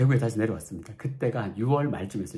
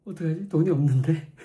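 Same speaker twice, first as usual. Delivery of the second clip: panicked; 6 semitones higher, 6 dB louder than usual. Retold or imitated. Panicking over no money.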